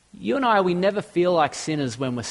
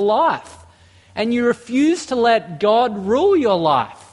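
full-force preaching; 4 semitones higher than usual. Acted. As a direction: drive the conviction home to the congregation. Preaching climax rising.

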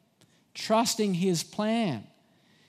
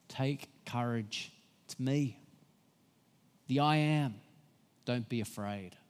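gentle; 6 semitones lower, 6 dB quieter than usual. Acted. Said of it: "speaks to them so gently, so lovingly".

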